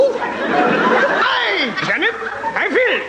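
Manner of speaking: put-on German accent. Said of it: "in a German accent".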